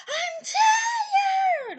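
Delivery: put-on high, piercing wail of a small child; imitated child's yell. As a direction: shriek it like an overtired kid waking everyone. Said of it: "all of a sudden I just hear".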